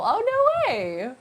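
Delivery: high, slow drawn-out gasp of disbelief; mock surprise. Real, not acted. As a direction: play up the astonishment. Surprised reaction.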